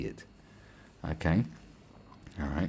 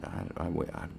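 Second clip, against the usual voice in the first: usual speaking voice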